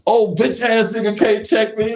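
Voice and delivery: imitated cocky trash talk. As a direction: taunt like a cocky young hooper.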